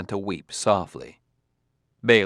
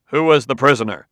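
sharp, louder demand; 2 semitones higher, 7 dB louder than usual. A narrator's reading. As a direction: insist on an answer. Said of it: "demanded Adelbish".

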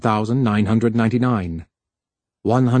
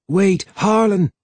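high-pitched call to wait; calling after; 8 semitones higher than usual. A narrator's reading. Twistle to the younger man running ahead, calling after. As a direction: call after someone hurrying off. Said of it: "called after the younger man".

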